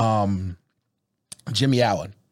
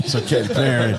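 smooth late-night DJ voice; late night radio voice. Shown as a demonstration. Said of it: "that's my late night radio voice".

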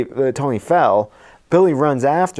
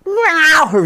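much higher, louder meow; cat meow; 5 dB louder than usual. Retold or imitated. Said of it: "And she goes".

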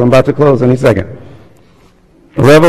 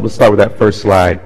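normal talking voice

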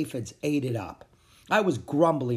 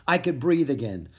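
ordinary delivery, nothing marked

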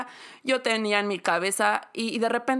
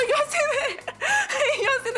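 high laughing squeal. Real, not acted. Recalling being told off, laughing high.

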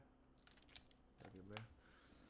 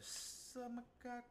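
mengejek; 9 semitones higher, 3 dB louder than usual. Retold or imitated. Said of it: "I said".

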